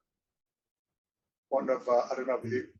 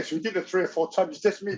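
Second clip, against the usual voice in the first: ordinary talk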